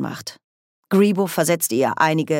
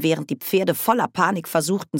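plain reading voice

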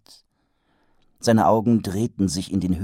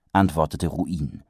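plain reading voice

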